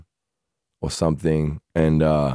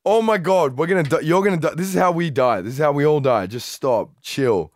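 higher, frightened fast plea; panicked pleading; 8 semitones higher than usual. Retold or imitated. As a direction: beg in terror. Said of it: "And I'm like".

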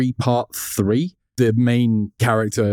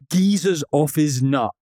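Cockney line; 5 semitones higher than usual. Retold or imitated. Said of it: "What is this, EastEnders?".

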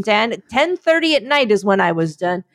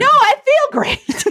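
much higher, slow and drawn out; lightly mock diva; slower than usual. Replying to Dan's compliment, high, slow and mock-preening.

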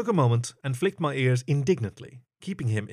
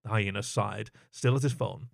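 usual speaking voice

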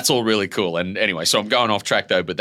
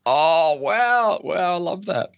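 delighted wow; 12 semitones higher, 4 dB louder, much slower than usual. Real, not acted. Moved by the interviewer's question.

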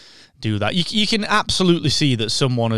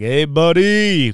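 drawn-out Poe; 3 semitones higher, 5 dB louder, much slower than usual. Retold or imitated. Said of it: "Poe's like".

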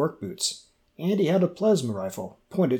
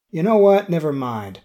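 exasperated; 4 semitones higher, 8 dB louder than usual. Acted. Joe refusing the blindfold.

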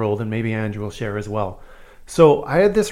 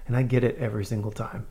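normal talking voice

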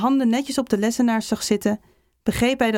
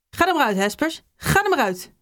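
higher-pitched, mildly furious teacher's order; angry calling; 5 semitones higher than usual. A narrator's reading. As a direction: send the pupil out in restrained anger. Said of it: "riep de leraar woedend". (boos roepend, met verhoogde stem)

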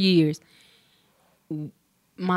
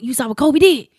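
dad excited; 7 semitones higher, 10 dB louder than usual. Retold or imitated. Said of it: "A good day is he'll pick up the phone".

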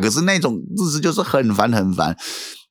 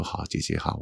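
whispered tearful yes; 5 semitones lower, 12 dB quieter than usual. Retold or imitated. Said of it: "很莫名其妙就哭了".

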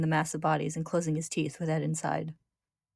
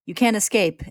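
louder, higher villain's cry; 4 semitones higher, 7 dB louder than usual. A narrator's reading. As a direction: call it out as a threat. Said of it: "Envy cries".

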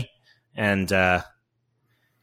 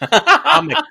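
"Comic" squeaked very high through a laugh; squeaky high voice; 6 dB louder than usual. Pretend.

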